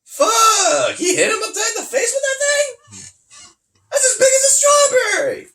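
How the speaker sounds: high, loud shocked outburst; yelling in disbelief, voice cracking up high; half laughing, half crying out in shock; shocked outcry. Real, not acted. Shocked at the ring punch.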